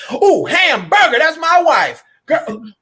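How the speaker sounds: hammed-up lovestruck king exclaiming; smitten king. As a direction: play the king gawking at his wife. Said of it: "He said".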